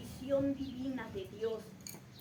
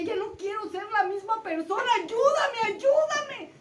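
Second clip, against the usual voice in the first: much higher, louder cry; desperate cry for help; 6 semitones higher, 8 dB louder than usual. Retold or imitated. Jacob crying out to God for help, high and loud. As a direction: cry out for help with all you have.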